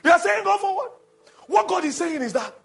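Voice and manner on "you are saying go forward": high, loud exhortation to a congregation; urgent, ringing pulpit cry; full-force preaching. Real, not acted. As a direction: shout it out like a preacher rousing the church.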